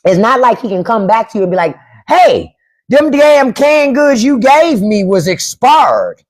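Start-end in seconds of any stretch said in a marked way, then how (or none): 2.1-6.2 slow, drawn-out Southern complaint, pitched higher; Southern accent. Retold or imitated. A mock neighbor complaining about expired canned goods in a Southern accent. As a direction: whine it like an old Southern man. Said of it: "that's how they say it down south".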